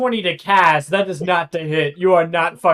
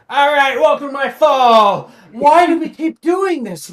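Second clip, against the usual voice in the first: whiny exasperated character; 8 semitones higher, 8 dB louder than usual. Acted. Wilfred voiced in scene.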